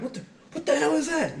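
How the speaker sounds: high-pitched incredulous outrage; retold outraged disbelief; pissed-off drill sergeant. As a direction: imitate a sergeant demanding an explanation. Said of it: "he got so pissed".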